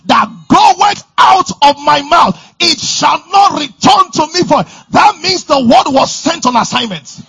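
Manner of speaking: loud, high, rousing preacher voice; preaching at full force. Acted. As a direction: drive it home to the congregation.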